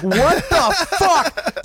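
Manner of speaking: high, drawn-out laughing what the fuck; baffled outburst. Retold or imitated. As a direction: react in stunned disbelief. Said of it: "I'm like".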